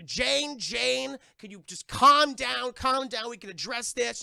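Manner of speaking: high, loud pleading shout; yelling. Retold or imitated. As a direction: yell to calm someone who is out of control. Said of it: "Timothy Dalton is yelling".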